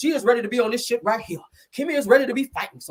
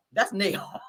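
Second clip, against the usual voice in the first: normal talking voice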